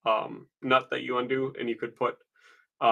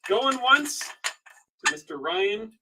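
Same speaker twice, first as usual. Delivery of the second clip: high sing-song auction call; auctioneer call; 6 semitones higher than usual. Real, not acted. Auctioneer calling for bids.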